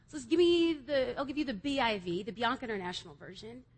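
normal talking voice

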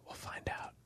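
hushed, teasing whisper; suspense whisper. Acted. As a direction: whisper it like a secret. Teasing suspense after playoff question.

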